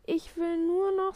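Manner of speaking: put-on high-pitched little boy's excuse; pleading child. A narrator's reading. Willi answers his cross Papa, pleading child.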